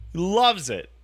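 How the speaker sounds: normal talking voice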